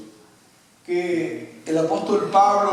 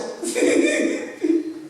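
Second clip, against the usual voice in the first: risa aguda. Real, not acted. Preacher laughs at own saying.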